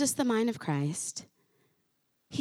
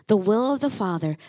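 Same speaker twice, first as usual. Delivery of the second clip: usual speaking voice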